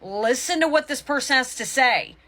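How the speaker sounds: ordinary delivery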